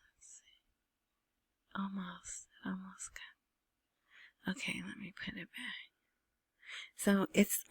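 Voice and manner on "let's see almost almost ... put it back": very soft, half-voiced murmur; muttering to self. Real, not acted. Vlogger hunting for a video to show, muttering to self. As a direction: think aloud under your breath while fiddling.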